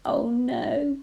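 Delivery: ordinary delivery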